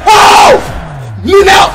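loud, high burst; excited shout. Real, not acted. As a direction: hype it up. Pull reaction.

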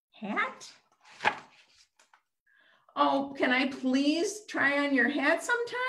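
high pleading ask; eager cyclops voice. Acted. Cyclops puppet begging the cake for its hat, high and sweet. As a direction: beg sweetly to try the hat.

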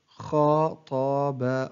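very slow, each syllable drawn out separately; broken into syllables. Shown as a demonstration. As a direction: sound out the word syllable by syllable. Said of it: "تقطع الكلمة إلى حروف". (تقطيع الكلمة ببطء شديد مقطعًا مقطعًا)

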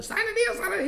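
very high-pitched mock demon screech. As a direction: imitate a demon manager, shrilly high. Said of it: "heard a whole demon out of his manager".

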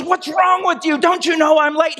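high-pitched, exasperated outburst; acted fit of rage. Converted husband lashing out at his wife again, acted rage. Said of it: "in a fit of rage".

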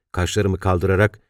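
ordinary delivery

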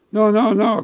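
quick, loud, high no no no; hasty denial. Acted. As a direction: back off fast and flustered.

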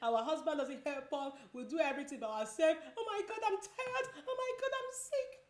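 wife moaning about her husband, mock whine; mock complaining mom. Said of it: "we complain too much, like".

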